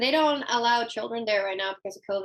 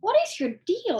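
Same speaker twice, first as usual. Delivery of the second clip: higher, exasperated question; 8 semitones higher than usual. Retold or imitated. Owner to her begging dog, exasperated and raised. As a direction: ask the dog what his problem is. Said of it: "And I'm like".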